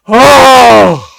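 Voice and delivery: high, loud startled shout; imitated loud shout. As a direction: yell out at the nasty sneeze. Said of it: "this kid goes".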